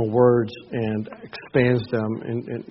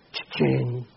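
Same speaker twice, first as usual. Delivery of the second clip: ordinary talk, nothing marked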